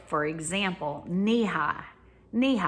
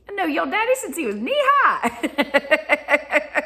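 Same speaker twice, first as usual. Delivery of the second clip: mock old lady, laughing; 7 semitones higher, 4 dB louder, slower than usual. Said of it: "grocery lady quoted a third time".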